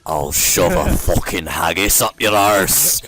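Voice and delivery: low, loud and slow right on the mic; leaning into the mic. Shown as a demonstration. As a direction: lean in close and growl it out. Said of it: "Just lean into the mic like".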